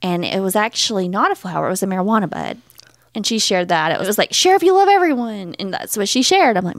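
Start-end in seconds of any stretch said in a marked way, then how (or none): 4.3-5.5 high, sing-song post caption; sugary meme voice. Retold or imitated. Mocking the mom's shared post in sweet tones. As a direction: read it like a gushing meme.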